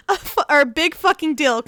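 usual speaking voice

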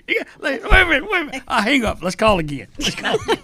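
high, loud, giddy caller; excited caller. Acted. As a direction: squeal it like a thrilled fan. Caller voicing amazed broccoli fan.

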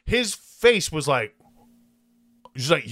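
usual speaking voice

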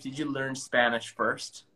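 ordinary delivery

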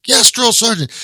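high, loud recruit bark; barked military recruit reply. Retold or imitated. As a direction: snap it back at the sergeant. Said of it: "I'm like".

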